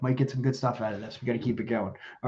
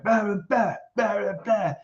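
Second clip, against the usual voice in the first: higher, sing-song mock of noisy protesters; 7 semitones higher, slower than usual. Host mocks climate protesters interrupting a talk, higher and sing-song. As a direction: parody them as empty noise.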